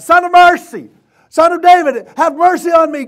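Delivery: very high, loud acted plea; full-voiced beggar's shout; desperate crying out. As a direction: cry out over a crowd for mercy. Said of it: "And he cried out".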